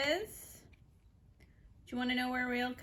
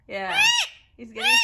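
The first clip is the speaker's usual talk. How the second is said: higher and louder; excited; 11 dB louder than usual. Real, not acted. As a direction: burst out excitedly. Excited shout.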